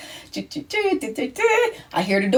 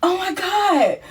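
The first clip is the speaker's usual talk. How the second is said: shocked; 7 semitones higher, slower than usual. Retold or imitated. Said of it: "So I'm like".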